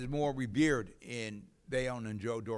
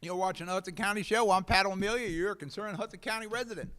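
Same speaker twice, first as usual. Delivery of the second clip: host intro; 8 semitones higher, 6 dB louder than usual. Acted. Host announces show loudly.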